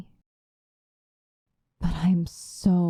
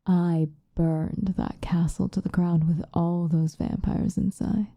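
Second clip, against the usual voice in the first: ordinary delivery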